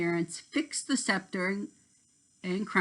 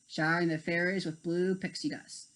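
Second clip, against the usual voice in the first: usual speaking voice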